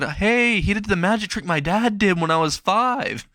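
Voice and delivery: high-pitched, excited exclamation; wide-eyed, high-voiced bystander; excited onlooker. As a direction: call it out in amazement, pitch up high. Onlooker marvels at the disappearance.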